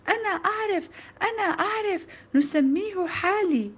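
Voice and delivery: put-on high-pitched eager child voice; enthusiastic. A narrator's reading. Pupil answering the teacher's question, enthusiastic. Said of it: "وقلت بحماس".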